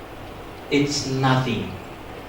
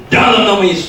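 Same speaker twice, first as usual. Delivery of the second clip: louder, higher second try at exorcism; forceful command; 4 semitones higher, 8 dB louder than usual. Retold or imitated. Friend retries exorcism after it failed, louder. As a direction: imitate an exorcist raising the volume.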